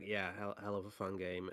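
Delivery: ordinary talk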